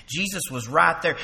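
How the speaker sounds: usual speaking voice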